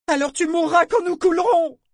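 put-on very high, loud childish yell; cracking child's voice. A narrator's reading. Boy retorting to a defiant slave, high cracking shout. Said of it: "sa voix se fêla, prenant le timbre aigu d'un enfant".

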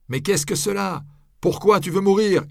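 high, loud cry of disbelief; shouted question. A narrator's reading. Onlooker cries out to the bound friar, high and loud. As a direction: call out to him in dismay. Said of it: "quelqu'un lui crier".

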